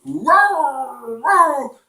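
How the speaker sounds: high, drawn-out dog noise; dog roar. Acted. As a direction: imitate the dog answering its owner. Narrator voices the dog below.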